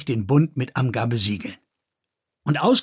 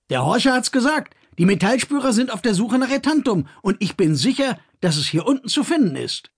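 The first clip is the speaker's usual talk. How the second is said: put-on higher, eager young miner pleading his case; Kors, eager young man; 8 semitones higher than usual. A narrator's reading. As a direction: brother pushing to descend, eager and insistent. Kors voiced in story.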